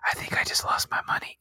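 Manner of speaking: softly whispered aside. Acted. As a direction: whisper it like a sheepish confession. [name] repeats whisper.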